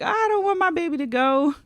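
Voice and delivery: high, loud, whiny mock cry; mother playfully wailing about her daughter leaving for college. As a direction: wail it like a mother who can't let go. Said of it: "even though I'm like".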